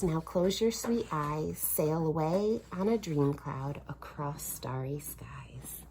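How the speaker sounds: ordinary delivery, nothing marked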